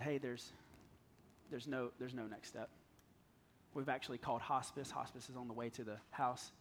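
low, soft doctor breaking bad news; subdued delivery of hard news; grave news.

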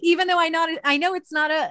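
laughing it off; words through a laugh. Real, not acted. Laughs after dramatic quote.

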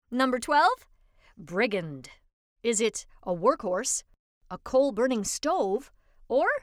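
normal talking voice

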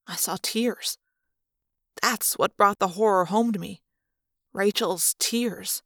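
ordinary delivery

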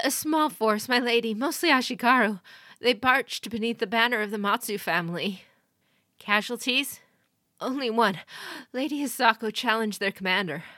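exhausted messenger reporting a defeat; urgent messenger. A narrator's reading. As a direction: deliver the grim news urgently. Messenger delivers grim news.

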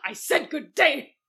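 loud, high parting shot; mock indignant dismissal. Hostess ordering guests out, mock indignant. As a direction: insist on it, hammed-up and offended.

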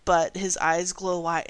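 ordinary talk

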